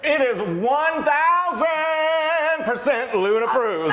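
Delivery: high, drawn-out singsong cry of approval; elated, stretched-out high proclamation; excited screaming. Real, not acted. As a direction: belt it out slowly, way up high. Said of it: "I'm screaming".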